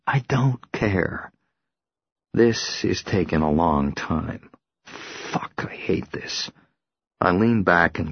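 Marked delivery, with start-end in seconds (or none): 0.1-1.3 slow whisper, stressing don't. Acted. Repeat as whisper. 5.3-6.5 a soft, hissed whisper; whispered curse. Acted. Whispered curse in police car.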